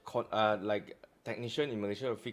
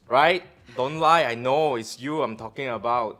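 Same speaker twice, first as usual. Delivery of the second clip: loud accusing; 11 dB louder than usual. Real, not acted. Calling out viewers.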